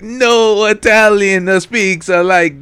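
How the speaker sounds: loud, high, drawn-out mock Italian accent; Italian restaurant accent. Mocking the cohost's protest, hammy Italian restaurant accent. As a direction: shout it slowly in a hammed-up Italian accent. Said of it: "Italian restaurant accent".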